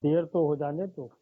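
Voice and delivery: poetry recitation. Acted. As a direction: linger on each line for the audience. S2 recites verses at mushaira.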